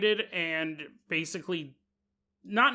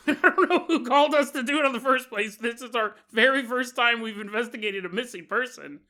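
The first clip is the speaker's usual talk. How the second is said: laughing; 5 semitones higher, 4 dB louder than usual. Acted. Joking voice for fire department.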